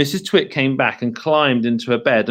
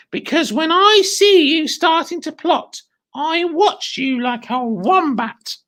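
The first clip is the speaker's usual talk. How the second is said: witchy wife voice; 13 semitones higher, 4 dB louder than usual. Acted. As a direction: sneer it like a spiteful old wife. Mrs Twit voiced in story.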